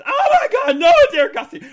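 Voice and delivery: high loud oh my God; mock outrage; shocked shriek. Real, not acted. Reacting to cheeky comment.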